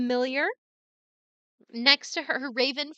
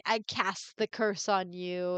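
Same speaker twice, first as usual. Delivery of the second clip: ordinary talk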